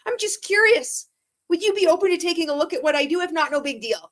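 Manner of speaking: mock an eager cold message, fast and high; chirpy sales pitch. Mocking her old MLM cold messages in a fast, high sales voice. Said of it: "cold messaging".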